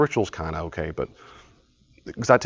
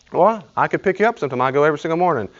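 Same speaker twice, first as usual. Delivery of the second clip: high, quick helpful friend; eager helpful offer; 6 semitones higher, 3 dB louder, faster than usual. Retold or imitated. Friend offering rides to the gym, quick and eager. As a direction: push the offer brightly.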